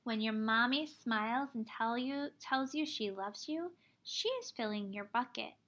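normal talking voice

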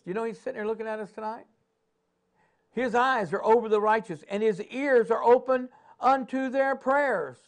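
rising, pressing preacher cadence; preaching. Acted. Preacher rallying his congregation, in full sermon cadence.

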